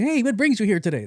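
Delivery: high, breezy imitation of the intake kid; mock nonchalant. Patient mocking the kid's greeting, exaggerated high nonchalance. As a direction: mimic an oblivious, carefree greeting way up high. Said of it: "being nonchalant".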